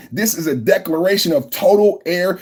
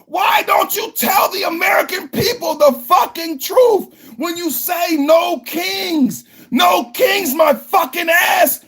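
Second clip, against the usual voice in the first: furious shout; 11 semitones higher than usual. Real, not acted. Ranting at No Kings protesters, furious breaking shout. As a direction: scream it out, voice cracking with fury. Ranting vlogger explodes at critics.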